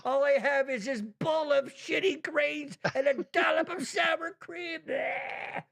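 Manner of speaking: high, slow whiny wife voice, lightly done; mock whiny complaint. Host imitating his wife complaining about her grain bowl, mock whine. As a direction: whine it like a disappointed diner.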